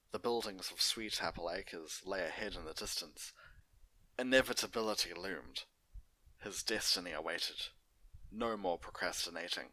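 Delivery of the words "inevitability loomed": usual speaking voice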